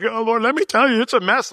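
high, loud put-upon moan; high, loud hammed-up complaint to the Lord; mock whiny complaint. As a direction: ham up unloading your troubles. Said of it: "I'm like".

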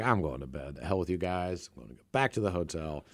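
fed-up friend. Retold or imitated. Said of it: "Sauce Man's had enough of our crap".

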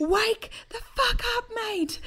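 exaggerated labouring rage; mock fury. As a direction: growl at him to wake up. Glaring at sleeping husband.

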